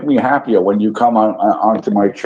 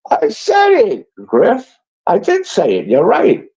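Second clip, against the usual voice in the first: exasperated admission; 11 semitones higher than usual. Real, not acted. Snapping back at chatter Griff.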